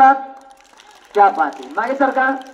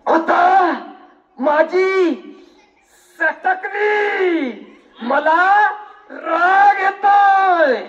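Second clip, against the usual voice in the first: high, drawn-out theatrical anger; slow, high-pitched show of fury, word by word; angry dialogue; 6 semitones higher, much slower than usual. Shown as a demonstration. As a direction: stretch each word out in a big acted rage. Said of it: "रागात dialogue बोलायचा".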